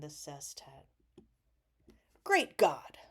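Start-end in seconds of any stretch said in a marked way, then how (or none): 2.2-3.0 exclaimed. Acted. Reads poem's exclamation.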